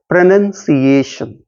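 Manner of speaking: "प्रोनंसिएशन" with a short NUN, falling at the end; correct pronunciation. Shown as a demonstration. Teacher repeating it for students to remember, careful correct "प्रोनंसिएशन". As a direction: say "प्रोनंसिएशन" carefully as pro-nun-see-ay-shun. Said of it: "ये ध्यान देना आपको".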